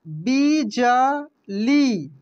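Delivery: बिजली stretched very slowly, each syllable separate. Shown as a demonstration. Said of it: "इसके बाद देखेंगे यहाँ पे". (बिजली को धीरे धीरे बोलिए)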